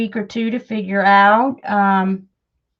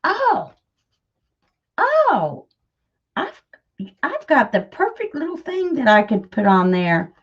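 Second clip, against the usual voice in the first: sudden inspiration; 4 semitones higher than usual. Retold or imitated. Vlogger recalling her late-night idea in bed, excited realization. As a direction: act out an idea striking. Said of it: "I was like".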